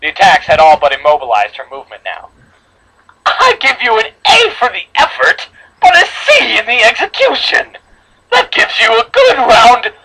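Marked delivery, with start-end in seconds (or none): 3.2-9.9 high, sing-song menacing voice; sweetly taunting killer, slow at the end; creepy cheerful villain. Acted. Creepy villain grades her victim.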